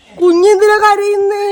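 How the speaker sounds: loud, high, drawn-out cooing question. Real, not acted. Asking the crying little one what's wrong, loud slow cooing.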